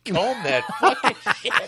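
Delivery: loud, high echo breaking into laughter; laughing mimicry. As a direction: mimic it loudly through your laughs. Echoes the clip while laughing.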